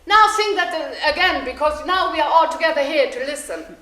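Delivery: loud, high and eager; eager shoemaker calling. Acted. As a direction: call the bird to sing again. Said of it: "So he said".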